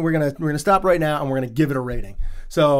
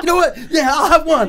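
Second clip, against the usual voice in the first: much higher, louder, laughing; Frank giving in; 13 semitones higher, 5 dB louder than usual. Retold or imitated. Frank finally agreeing to drink, high and gleeful. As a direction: cave in with a gleeful yes. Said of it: "And he's like".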